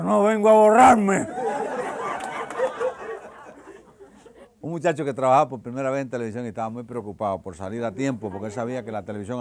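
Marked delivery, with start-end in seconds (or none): 0.0-1.3 put-on high, loud and slow drunken reply; drunk's punchline. 1.3-4.5 airy laugh high up; high breathy laugh. Real, not acted. Laughs after punchline.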